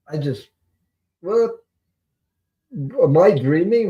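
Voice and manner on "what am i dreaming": high, questioning reenacted disbelief; incredulous. Telling how she found the bus money, astonished. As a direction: replay the bewilderment, pitched up.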